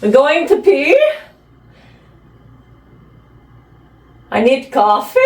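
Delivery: put-on playful old-lady British accent; eccentric old British lady. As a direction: announce your errands as a batty British lady. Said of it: "some eccentric old British lady".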